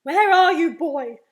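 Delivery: very loud, demanding fatherly shout. Acted. Daddy searching for his invisible boy, shouting. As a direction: bellow for the missing child. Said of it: "and he shouts".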